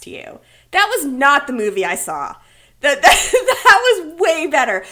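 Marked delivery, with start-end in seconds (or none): 2.8-4.8 laughing. Real, not acted. Laughing at the synopsis.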